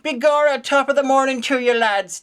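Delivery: mock stage Irish. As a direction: parody a cheesy American-TV Irish accent. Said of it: "Irish accents in American shows tend towards the".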